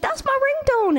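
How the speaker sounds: very high mock lady's voice; hammed-up, high-pitched fan impression; imitating excited fan. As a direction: squeak it like a thrilled audience member. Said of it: "she was like".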